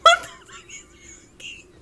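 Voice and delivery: can't believe what you just heard; gasping in disbelief; incredulous laugh. Real, not acted. Incredulous at misheard lyric.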